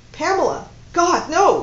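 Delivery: loud, high panicked calling of her name; alarmed cry. Acted. Man catching a collapsing woman, crying out in alarm. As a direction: cry out in sudden fear for her. She collapses in his arms.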